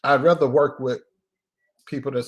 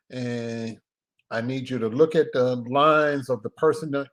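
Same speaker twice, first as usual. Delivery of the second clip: usual speaking voice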